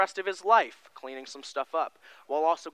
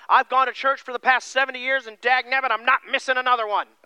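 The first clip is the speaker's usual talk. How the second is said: higher, louder hammed-up grumpy old timer; stubborn old churchgoer; 7 semitones higher, 8 dB louder than usual. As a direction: play a cranky regular who won't miss church. Mock stubborn lifelong churchgoer.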